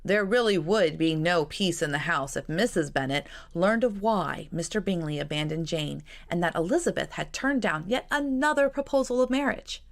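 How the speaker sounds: ordinary delivery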